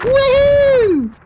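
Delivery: very high, loud mock whoop; triumphant whoop. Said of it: "He's like".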